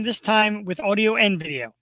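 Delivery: ordinary talk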